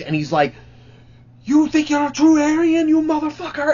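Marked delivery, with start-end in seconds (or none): 1.4-3.7 much higher-pitched, taunting challenge; mock him up high, daring him; angry confrontation. Bassist confronting Nazi fan.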